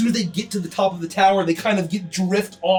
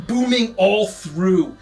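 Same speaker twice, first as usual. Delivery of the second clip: ordinary delivery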